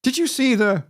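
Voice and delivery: high-pitched go at Scottish, abandoned; badly faked Scottish accent; failed Scottish accent. Shown as a demonstration. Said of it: "I can't do it".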